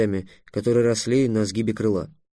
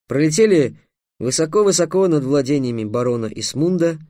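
usual speaking voice

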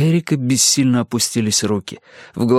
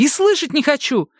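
furious refusal. A narrator's reading. Mother shouting at Erik.

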